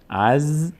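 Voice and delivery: "از" with a held z; vowelless final consonant. Shown as a demonstration. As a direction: draw out the buzzing z closing "از". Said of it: "به صورت ساکن".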